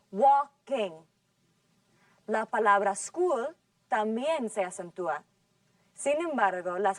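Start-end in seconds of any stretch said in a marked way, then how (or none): 0.1-0.9 Walking, louder on the first syllable WAL; stress on walking. Shown as a demonstration. Teacher to class, louder on the first syllable of Walking. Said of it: "la palabra walking se acentúan en la primera sílaba".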